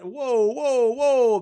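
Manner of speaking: loud, high whoa. Retold or imitated. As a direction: call out whoa to stop him. Bouncers stopping the thief.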